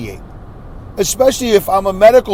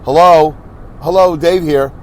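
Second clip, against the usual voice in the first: ordinary delivery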